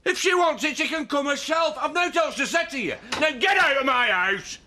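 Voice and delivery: high-pitched, heated shout; angry shouting. Acted. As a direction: shout them out of the house. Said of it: "No point in shouting at her".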